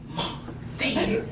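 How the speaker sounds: slow, hushed mock prompt; conspiratorial whisper. Son-in-law urging mother to tease, imitated sly whisper. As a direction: whisper it like egging someone on.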